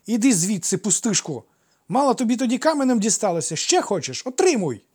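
put-on high, loud boyish threats; shrieky childish outburst; angry child. A narrator's reading. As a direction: hurl it like a furious little boy. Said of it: "заспаний дитячий голос".

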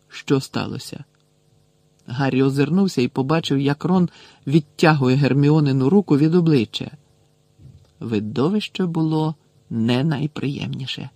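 ordinary delivery

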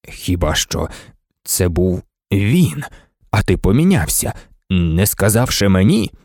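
low, tense dawning realisation; low, intent questioning. A narrator's reading. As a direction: work it out haltingly, voice low. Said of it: "заговорив незвичним, напруженим голосом".

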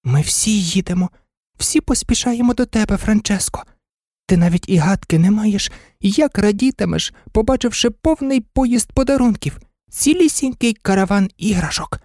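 high, tender-hearted doggy voice; little dog's thoughts. A narrator's reading. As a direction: talk to an absent friend in a high little voice. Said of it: "подумки вів розмову з Франческо". (високий голосок песика, що подумки говорить із другом)

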